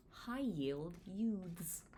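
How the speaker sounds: stretched slowly, word by word like a marquee; slow title reveal. Acted. Author teasing the next chapter title, slow reveal. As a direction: draw the title out slowly to tease it.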